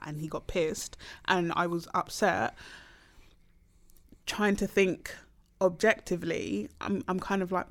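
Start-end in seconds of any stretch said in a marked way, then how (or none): none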